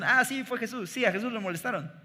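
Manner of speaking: a hint of a child tossing off the answer; offhand little boy. Preacher re-quotes the boy's glib reply, casual kid voice.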